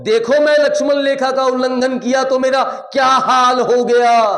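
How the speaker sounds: high, loud proclamation; loud acted outcry. Sita warning the world, loud high outcry. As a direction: cry it out as a warning to all.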